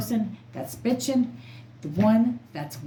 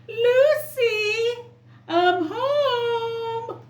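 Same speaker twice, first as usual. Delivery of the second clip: much higher, louder sing-song sitcom greeting; sitcom husband call; 5 dB louder, much slower than usual. Pretend. Vlogger joking to Lucifer, hammed-up sitcom greeting. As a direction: call out like a cheery fifties TV husband coming home.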